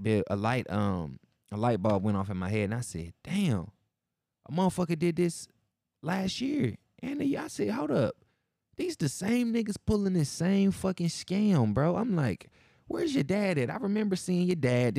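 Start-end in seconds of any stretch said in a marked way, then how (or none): 3.2-6.8 dawning realization. Retold or imitated. Host recalling the scam kid from last year, rising disbelief. 7.0-15.0 much higher, incredulous; high exasperated disbelief. Retold or imitated. Host confronting the kid about his dad, incredulous and high.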